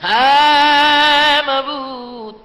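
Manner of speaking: loud, high, very long cry to God; wailing invocation. Real, not acted. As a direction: belt out a drawn-out supplication. Climax of prayer, imploring God.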